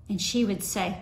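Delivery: ordinary talk, nothing marked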